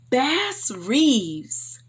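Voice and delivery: ordinary delivery, nothing marked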